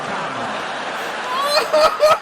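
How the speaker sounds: high laughing come on; squeaky laughing appeal; laughing plea. Acted. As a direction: beg while cracking up. Cajoling through laughter.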